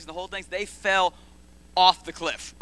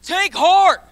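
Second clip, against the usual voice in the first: loud high exhortation; 12 semitones higher, 14 dB louder than usual. Real, not acted. Preacher exhorting.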